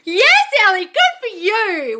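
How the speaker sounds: high, loud and slow congratulations; big happy praise for a viewer; delighted praise. Real, not acted. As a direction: cheer her on, drawing out good for you. Cheering a viewer's good news.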